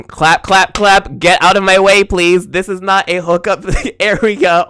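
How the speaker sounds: a sharp mock yell of clap, clap, clap; mock loud clap-back. To people in her way at the gym, a loud mock telling-off.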